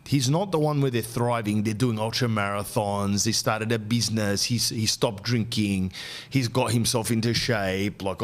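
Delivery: usual speaking voice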